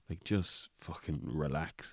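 slow, soft soothing mum voice; mum calming him. Retold or imitated. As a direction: settle him down gently and slowly. Mum calming angry son.

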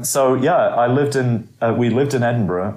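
normal talking voice

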